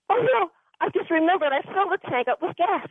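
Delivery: high-pitched dismayed realization; retold alarm. Retold sudden alarm after crash.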